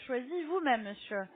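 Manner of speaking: ordinary delivery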